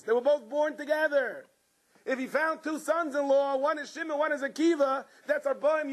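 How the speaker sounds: high, impassioned sermon voice; fervent preaching. Real, not acted. Rabbi rousing listeners about Rav Shimon, impassioned preaching. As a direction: preach it to the crowd with rising fire.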